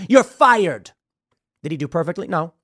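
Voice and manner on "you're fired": loud, higher, curt verdict; Trump firing someone. Retold or imitated. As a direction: hand down the firing like a boss. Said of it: "That's what I think of when I think of Trump".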